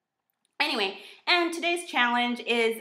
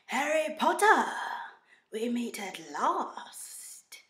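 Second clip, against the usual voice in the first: creepy villain, drawn out and trailing down; theatrical dark-lord voice; Voldemort; much slower than usual. Acted. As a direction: stretch it slowly, sinister and creepy. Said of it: "I suppose this Voldemort voice".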